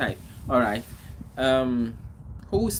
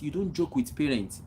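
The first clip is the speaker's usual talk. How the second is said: ordinary delivery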